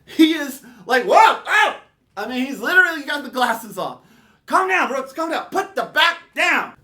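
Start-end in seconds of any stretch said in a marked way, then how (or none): 1.0-1.8 high, loud acted yelps; alarmed father. Father dodging the swung bat. 4.5-6.7 high, urgent mock pleading; alarmed father. Father pleading with frantic son.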